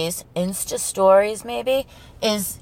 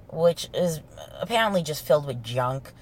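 usual speaking voice

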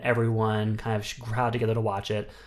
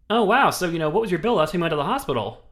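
higher and louder, quick, feigned wonder; mock faux-amazed interviewer; 6 semitones higher, 4 dB louder, faster than usual. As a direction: play dumb to sound amazed. Moore faking surprise in interview.

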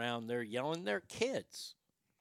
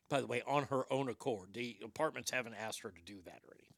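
whispered aside; 4 semitones lower, 8 dB quieter than usual. Real, not acted. Aside muttered under breath.

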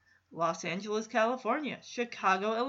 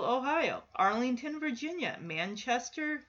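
ordinary delivery

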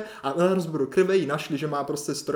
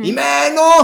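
high, loud mock bellow; retold roar; 10 semitones higher, 11 dB louder than usual. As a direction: roar it like a fierce doctor demanding details. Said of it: "jako fakt řve". (řev přísné doktorky)

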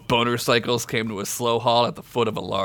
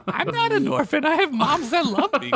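put-on very high kid voice; indignant little girl. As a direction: protest like a tiny elf. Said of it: "Tina said".

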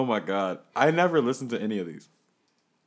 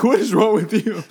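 ordinary delivery

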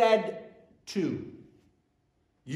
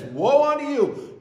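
louder, higher stern "Woe unto you"; stern Jesus; 6 semitones higher, 7 dB louder than usual. Retold or imitated. Preacher voicing Jesus condemning a listener, stern. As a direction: pronounce judgement as Jesus would. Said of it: "If Jesus looks at you and says".

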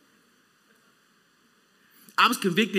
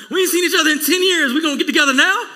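incredulous mock; 6 semitones higher, 6 dB louder, faster than usual. Mocking the reunion offer.

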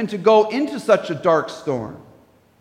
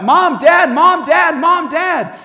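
put-on higher, louder frightened child calling for parents; scared kid calling; 9 semitones higher, 7 dB louder, much slower than usual. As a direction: call out like a terrified child in the dark. They start calling out.

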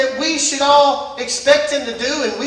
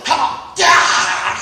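mock deliverance shout; 3 semitones higher, slower than usual. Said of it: "they're over there shaking them".

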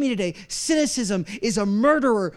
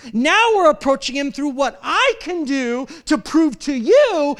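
raised, driving sermon voice; impassioned preaching; 7 semitones higher, 8 dB louder than usual. Acted. Preaching at full force.

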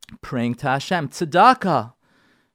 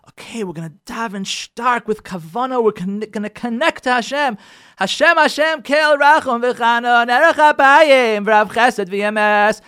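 loud, slow, higher chanting of the attributes; fervent prayer; 4 semitones higher, 6 dB louder than usual. Retold or imitated. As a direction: cry out the prayer with full fervour. Fervent slichos chanting voiced.

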